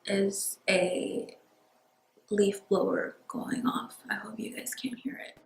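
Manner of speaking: soft unvoiced whisper. Real, not acted. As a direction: hush it under your breath. Quietly remarking on noise outside.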